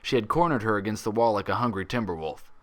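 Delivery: ordinary delivery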